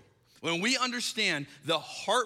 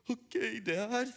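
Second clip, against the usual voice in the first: slow, higher-pitched mock-tearful girl; imitated tearful child; 5 semitones higher, much slower than usual. Sobbing daughter accepting her dad's reassurance, imitated tearfulness. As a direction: reluctantly drag it out like an upset child. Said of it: "she's sobbing".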